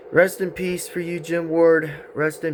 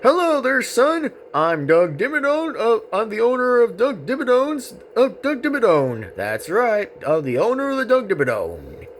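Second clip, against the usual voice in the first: high, swooping cowboy character voice; hearty cartoon cowboy twang; Doug Dimmadome; 8 semitones higher than usual. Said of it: "I'm gonna just say is this".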